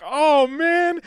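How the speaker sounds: high, put-on letdown groan; a voiced caller realizing his mistake; mock crestfallen Steve; Steve deflated.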